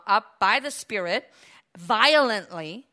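usual speaking voice

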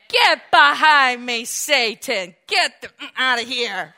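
loud, slow, commanding rebuke; stern rebuke. Acted. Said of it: "That's what he said".

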